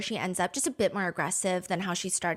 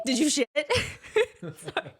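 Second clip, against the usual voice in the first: cracking up mid-question; laughing too hard to finish; real laughter; 4 semitones higher than usual. Real, not acted. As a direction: burst of helpless laughter. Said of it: "we were literally losing our minds".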